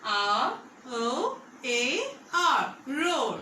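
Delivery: letters R O A R spaced with pauses, then "roar"; teacher spelling "roar" for the class; spelling aloud. Shown as a demonstration. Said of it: "Once again, please".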